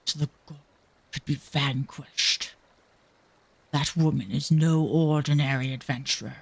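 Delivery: low, measured character voice; ominous onlooker. Acted. Onlooker judging a remarkable adventurer, low and grave. Voices a watching story figure.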